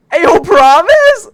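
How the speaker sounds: loud, high, stretched-out question; excited disbelief. Real, not acted. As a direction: shout it slowly, rising in delight. Excited reply to a viewer.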